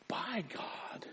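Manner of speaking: near-whispered, weighty phrase; hushed, breath-led emphasis; hushed awe. Real, not acted. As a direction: drop to a reverent whisper. Reverent emphasis on who picks you.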